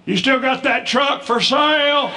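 put-on high, slow hearty old man asking. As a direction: slip in a sales pitch mid-blessing. Dad asking candidate.

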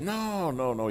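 high, drawn-out protest; emphatic denial. Retold or imitated. Lawyer reassuring alarmed preacher, high protesting denial. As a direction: wave the idea away, pitch leaping up. Friend denying he wants judge killed.

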